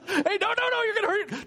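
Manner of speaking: a dad squeaking in mock panic; mock alarm. Dad stopping kid with hammer.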